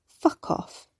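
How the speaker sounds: voiceless, tearful dismissal; holding back sobs. A narrator's reading. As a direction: push it out on breath, swallowing a sob. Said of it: "sniffed, gulping back a sob".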